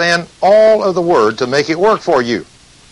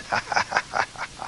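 soft breathy demon cackle; a quiet sneering laugh from the dark; demon's mocking laugh; 13 dB quieter than usual. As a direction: demon laughs off the rebuke, softly.

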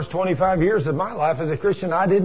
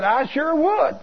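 very high-pitched, eager acceptance; instant eager yes; 12 semitones higher, slower than usual. Retold or imitated. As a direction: jump at the offer without a thought. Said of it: "Just that quick".

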